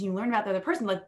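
usual speaking voice

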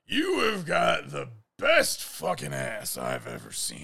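put-on slow, brash, leering old drunk; loud leering shout. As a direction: bellow it across the patio. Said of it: "and he's like".

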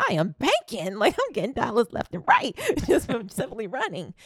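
put-on laughing through a kid's glee; bragging child, giggling; gleeful child. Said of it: "I'm thinking in my five-year-old mind".

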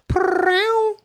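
high purring chirp; cat trill. Retold or imitated. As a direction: do a chirpy cat purr-row. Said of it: "I had a cat that go".

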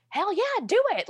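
high, thrilled cheer from her parents; excited parents. Retold or imitated. Parents urging their daughter to move, a high thrilled cheer. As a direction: squeal it like proud parents giving a yes.

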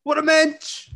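high, loud Yiddish exclamation; yiddish accent. Acted. Yiddish-flavored jabs at Phil.

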